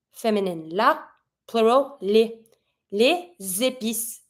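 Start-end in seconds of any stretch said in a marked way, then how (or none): 2.9-4.2 slow, drawn-out "Les épices" as lay-zay-peess; slow liaison in "Les épices"; linked S. Shown as a demonstration. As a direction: carry a Z sound into "épices" from "Les". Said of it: "I'll link it with my S".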